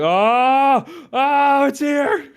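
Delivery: high, loud squealing gasp of delight; giddy shriek of recognition; excited squeal. Retold or imitated. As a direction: squeal like a fan who just got the reveal. Excited fan reaction to reveal.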